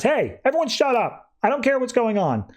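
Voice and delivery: high, forceful call to shut up; Flash cutting in. Retold or imitated. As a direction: silence the bickering room. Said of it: "Flash comes in and says".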